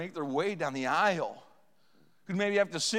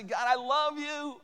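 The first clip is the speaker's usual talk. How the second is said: slow, higher, heartfelt thanks to God; loving prayer; 7 semitones higher, slower than usual. Shown as a demonstration. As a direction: pray it slowly and tenderly.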